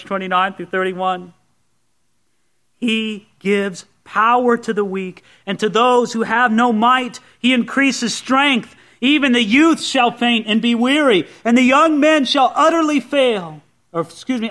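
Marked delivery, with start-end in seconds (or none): 2.8-13.6 emphatic scripture declamation, building higher and louder; preached scripture reading. Acted. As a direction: proclaim the verse to a congregation.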